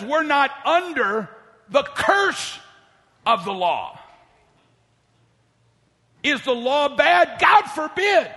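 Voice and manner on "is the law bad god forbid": high, loud preacher's cry; preaching shout. Real, not acted. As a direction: shout it from the pulpit. Preacher shouting rhetorical question.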